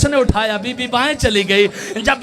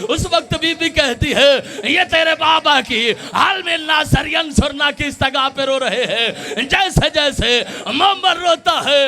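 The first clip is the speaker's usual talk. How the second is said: impassioned preaching; 5 semitones higher than usual. Acted. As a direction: cry the lament out to the mourners. Majlis preaching, wailing quote. (ऊँची आवाज़ में रोता हुआ मजलिस बयान)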